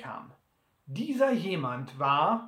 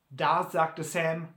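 usual speaking voice

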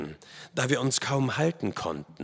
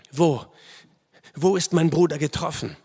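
higher, urgent, halting first word; anxious question; 5 semitones higher, 5 dB louder than usual. A narrator's reading. Narrator kneeling by wounded brother, anxious higher question. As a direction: ask urgently after a wound.

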